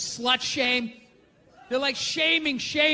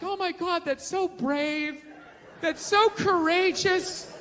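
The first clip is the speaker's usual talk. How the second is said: slow, gushing fake awe, higher and laughing; mock gushing; 5 semitones higher, slower than usual. Fans cooing over a magazine cover, slow mock gushing. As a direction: drag it out like a fawning fan. Mocking fans hyping up actresses.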